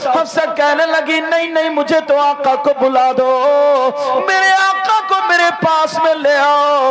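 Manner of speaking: loud, high, impassioned sermon delivery; full force preaching. Acted. Preacher tells the crowd the Prophet's story, at full force.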